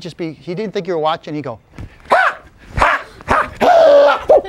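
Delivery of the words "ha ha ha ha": short high bursts, the last long and loud; imitated cat noise. Storyteller acting out Bat Cat for listeners, high cat-like bursts. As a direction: act out the cat with sharp, high cries.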